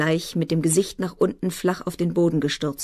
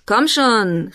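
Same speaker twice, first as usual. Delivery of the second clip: higher, louder taunt; raised, pugnacious challenge; belligerent call; 5 semitones higher, 5 dB louder than usual. A narrator's reading. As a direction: goad them into a fight. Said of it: "rief Saffe streitlustig".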